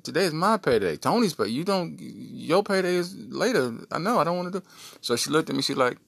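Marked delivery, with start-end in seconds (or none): 0.0-4.6 put-on higher, whining kid; a kid pleading over his paycheck; whiny protest. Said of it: "I'm like".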